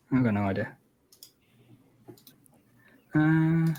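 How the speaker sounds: usual speaking voice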